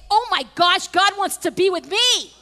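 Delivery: amazed exclamation. Shown as a demonstration. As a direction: exclaim it as joyful news for the congregation. Gives congregation an amazed line to say.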